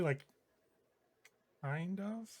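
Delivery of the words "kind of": much softer, sheepish little admission. Acted. Yona sheepishly admits to the roommates she has an assassin contact, quiet. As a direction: admit it sheepishly, almost hiding. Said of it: "almost sheepishly".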